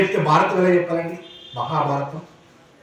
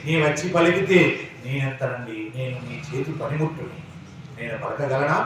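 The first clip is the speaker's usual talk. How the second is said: prayer to God; 3 semitones lower than usual. Acted. Preacher turns to pray before the audience, pleading. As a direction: humbly beg God to let him speak.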